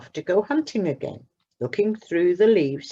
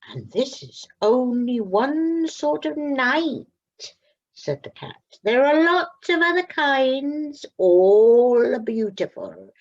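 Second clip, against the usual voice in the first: cat voice; 8 semitones higher, 5 dB louder than usual. Acted. Cat speaks on rooftop.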